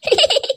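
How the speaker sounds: very high-pitched giggle; short squeaky snicker. Acted. Giggle at flirty proposal.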